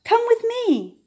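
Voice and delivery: high, bright come with me; eager invitation. A narrator's reading. Little beaver happily inviting the duck along. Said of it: "said Little Beaver".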